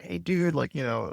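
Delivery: high, easy "hey dude"; laid-back bro greeting. Retold or imitated. Buddy greeting over cocktails with the founder, high and casual. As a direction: greet the CEO like an old drinking buddy. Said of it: "we'd be like".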